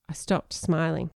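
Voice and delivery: plain reading voice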